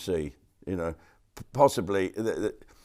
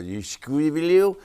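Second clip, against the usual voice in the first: silly squirrel voice; 6 semitones higher, 4 dB louder, much slower than usual. Pretend. Holding up squirrel mugs, silly voice.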